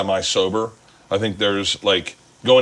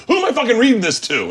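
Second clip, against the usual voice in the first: imitating an exasperated mom's outburst; high, loud, acted-out scolding; losing her shit; 12 semitones higher, 5 dB louder, faster than usual. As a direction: snap like a mom who's had it. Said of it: "she'd lose her shit".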